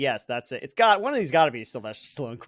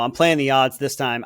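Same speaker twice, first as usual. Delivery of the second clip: ordinary delivery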